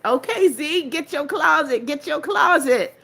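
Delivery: playful teasing. Real, not acted. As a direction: tease her playfully about the closet. Teasing a viewer about closet.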